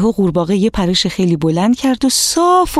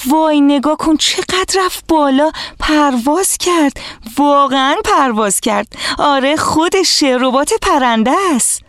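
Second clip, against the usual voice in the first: put-on excited children's cries; 8 semitones higher than usual. A narrator's reading. Children watching the drone fly up, squealing with excitement. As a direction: call out in wonder like thrilled kids.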